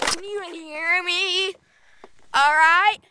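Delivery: loud call across the hill. Real, not acted. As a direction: shout it out. Calling out on the hill.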